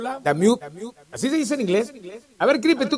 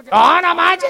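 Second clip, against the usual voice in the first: higher, indignant mock whine; mock protest; 9 semitones higher, 4 dB louder, much slower than usual. Imitating listeners complaining about parking, higher mock protest. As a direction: play the crowd balking at the fee.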